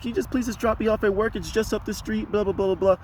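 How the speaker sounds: high, soft pleading; imitated crying woman. Crying passenger asking for a lift to work, high and soft. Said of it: "crying in the corner".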